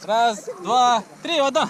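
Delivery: counting up before the dive; psyching up to plunge; excited countdown. Real, not acted.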